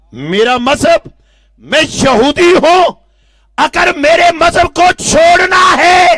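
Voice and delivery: loud, high angry warning; uncle's threat. Acted. Uncle threatening his nephew over his religion, loud. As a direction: bark the ultimatum.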